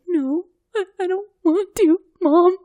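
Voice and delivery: high, whiny tearful plea; teen pleading with her mom, high and teary; imitating crying plea. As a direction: beg Mom in a mock-tearful voice.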